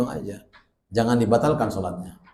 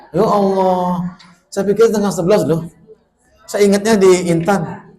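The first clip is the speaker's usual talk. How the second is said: raised, louder flustered surprise; surprised; 7 semitones higher, 5 dB louder than usual. Real, not acted. Preacher realizes he misjudged the time, flustered. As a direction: caught off guard about time.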